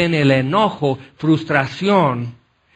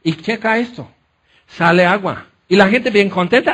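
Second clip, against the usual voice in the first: usual speaking voice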